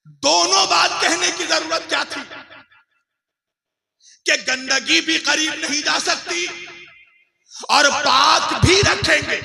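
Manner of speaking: loud, insistent rhetorical question; forceful preaching. Real, not acted. Preacher asking why both statements were needed, forceful preaching. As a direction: press the question on the congregation.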